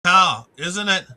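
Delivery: usual speaking voice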